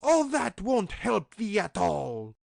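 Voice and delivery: high, loud witch scolding. A narrator's reading. Witch silencing Grethel, high loud scolding voice. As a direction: snap at the girl like a witch. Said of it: "said the old woman".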